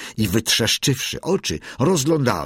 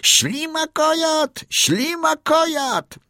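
very high, drawn-out taunting shriek; high, stretched mocking call; full-throated yell; slower than usual. A narrator's reading. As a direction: screech the insult slowly, sky-high. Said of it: "wrzasnął na całe gardło".

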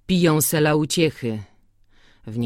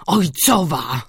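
głośniej, z goryczą, już pełnym głosem; wyrzuć to słowo z bólem; gorzki wykrzyknik; slower than usual. Acted. Antek wybucha po szepcie. (po szepcie Antek wybucha gorzko, pełnym głosem)

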